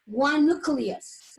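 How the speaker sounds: slow, emphatic teacher point; slow emphasis. Shown as a demonstration. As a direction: stress the key term for students.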